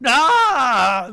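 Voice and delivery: loud, high theatrical scream; hammed-up cry of horror. A narrator's reading. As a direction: let out a full scream. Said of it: "the lead Kamath screamed".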